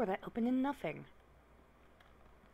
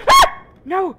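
shrieking high and loud; terrified scream; 6 semitones higher, 24 dB louder than usual. Real, not acted. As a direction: scream in sheer terror. Monster attacks suddenly.

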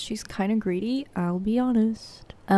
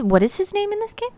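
high exasperated; 12 semitones higher, faster than usual. Real, not acted. Frustrated over forgotten name.

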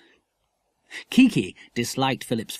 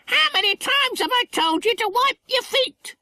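very high squawky parrot scolding like a nanny. A narrator's reading. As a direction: screech it like a bossy parrot telling off a pup. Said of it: "Kiki demanded of Button".

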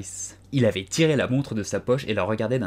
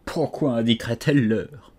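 plain reading voice

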